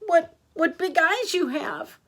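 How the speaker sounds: put-on high, faltering child's amazement; distressed. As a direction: stammer it as a worried little girl. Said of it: "was distressed at seeing her grandmother".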